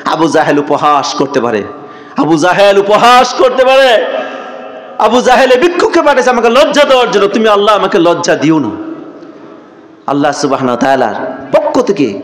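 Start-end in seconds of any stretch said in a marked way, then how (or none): 2.2-4.0 high, ringing repeat of a plea; raised, intoned sermon cry; impassioned preaching. Retold or imitated. Same words repeated with raised voice. 5.0-8.7 high, intoned prayer that comes down gradually; acted pleading in a preacher's chant; impassioned plea.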